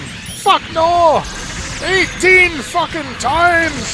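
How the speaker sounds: mock Scottish shout. Scotsman yelling how many holes golf has. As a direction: bellow the count like a mad Scot. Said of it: "a riff on, like, Robin Williams' Origin of Golf".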